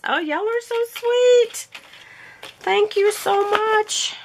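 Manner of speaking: very high, touched thank-you; high sweet gushing voice. Real, not acted. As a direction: coo your thanks to the senders. Thanking the senders.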